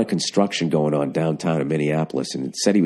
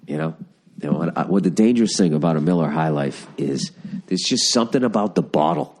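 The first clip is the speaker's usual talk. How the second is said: ordinary delivery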